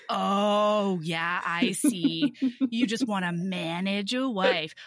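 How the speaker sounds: low, drawn-out mock realisation; slow, knowing sarcasm; sarcastic understanding. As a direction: feign understanding with heavy scorn. Her sarcastic reply about husbandry school.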